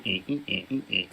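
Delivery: soft, low electronic stutter sound; stuck car noise. Retold or imitated. S1 mimics the Batmobile stuck beeping, soft and low. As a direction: mimic a broken car going eh eh. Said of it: "stuck going".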